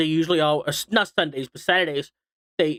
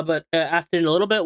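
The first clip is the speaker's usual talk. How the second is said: usual speaking voice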